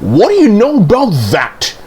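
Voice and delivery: high-pitched astonishment; amazed exclamation. A narrator's reading. Percy remarks on Thomas's hasty exit, high amazement. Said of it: "he exclaimed".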